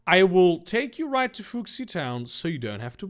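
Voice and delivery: kindly offer, lightly put on; lightly voiced fish man. Fishman continues.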